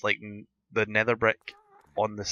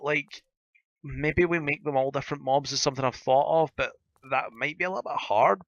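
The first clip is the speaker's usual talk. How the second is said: ordinary talk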